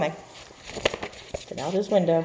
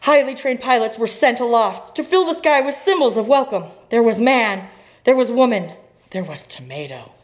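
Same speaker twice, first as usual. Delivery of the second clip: louder, higher dramatic read-aloud; story narration; 8 semitones higher, 8 dB louder than usual. A narrator's reading. Teacher reading the welcome symbols page, dramatic narration. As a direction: announce it like a grand storybook event.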